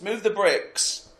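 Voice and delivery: drawn-out imitation of a workman's call; mock site shout. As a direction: call out slowly as if across a building site. Acting dad on building site.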